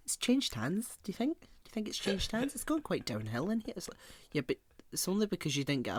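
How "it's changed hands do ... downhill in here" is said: high, soft mock-worried questioning; discreet gossip. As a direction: imitate relatives quietly fretting. Acting relatives' discreet restaurant gossip.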